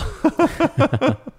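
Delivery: high, loud burst of laughter; loud, real high-pitched laugh. Real, not acted. Laughing at parenting win joke.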